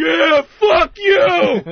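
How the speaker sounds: high, loud, drawn-out angry yell; enraged crowd imitation. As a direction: scream it like a whole mob provoked. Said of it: "they would all start giving the finger".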